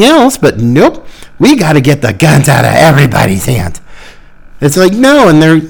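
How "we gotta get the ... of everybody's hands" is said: mocking quote. Mocking the anti-gun crowd.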